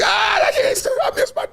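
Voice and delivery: mock the mourner wailing and breaking off. Mocking a grieving mourner at a funeral, hammed-up wailing cry.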